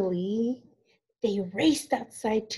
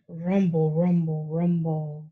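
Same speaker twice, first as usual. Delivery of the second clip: low, growly rumble of thunder; rumbling thunder; 7 semitones lower than usual. Acted. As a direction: rumble it deep like a storm rolling in. Thunder rumbling in picture book.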